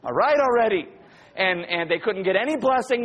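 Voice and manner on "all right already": high, louder mock exasperation; imitating children's impatience; impatient kids. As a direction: burst out like a fed-up kid. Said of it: "the kids are like".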